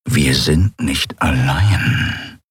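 slow, low predator growl; a slow, menacing beast-like snarl. Acted. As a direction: growl the warning slowly like a big cat. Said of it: "knurrte er".

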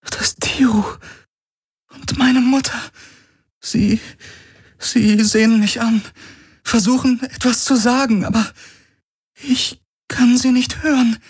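very high, halting, tearful voice; high, fragile voice with broken pauses; near tears. A narrator's reading. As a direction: falter as if about to cry. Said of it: "Sie schien den Tränen nah".

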